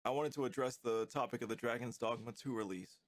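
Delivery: usual speaking voice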